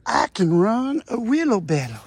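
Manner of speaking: put-on proud and eager, like a kid asking for work; imitating Rodney. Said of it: "as little Rodney would say".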